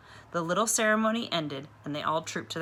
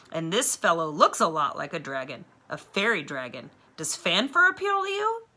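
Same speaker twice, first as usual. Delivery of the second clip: eager teacher; 3 semitones higher, 7 dB louder than usual. A narrator's reading. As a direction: announce the idea with relish. Teacher proposing the name Fafner.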